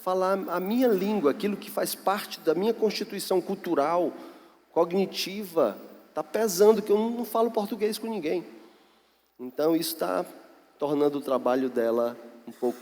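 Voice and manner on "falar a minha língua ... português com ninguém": higher, softer retelling of her complaint; lightly voiced missionary's plea. Lonely missionary telling her pastor it weighs on her, lightly imitated plea. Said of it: "Ela diz".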